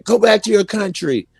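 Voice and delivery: high-pitched mock holler of a nationalist; mock patriot heckler. Host mimics the guy whose god is America, high mocking holler. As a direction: send it up as an America-worshipper, voice jumping high. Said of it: "he's the one saying".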